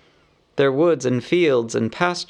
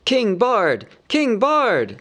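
much higher chanting cheer; crowd shouting; 9 semitones higher, slower than usual. A narrator's reading. Townsfolk hailing Bard as king: high cheering shout. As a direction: hail the new king. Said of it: "they shouted".

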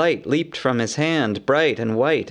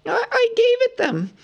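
very high frightened squeak. A narrator's reading. Bilbo confesses from the wall, squeaking in fright. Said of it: "squeaked Bilbo".

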